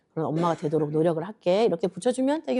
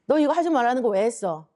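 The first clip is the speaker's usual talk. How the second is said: higher mock scolding; angry mother scolding; 4 semitones higher than usual. Mother scolding her child for disobeying, angry scolding. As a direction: tell off a child in pretend anger. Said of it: "엄마는 화가 나니까".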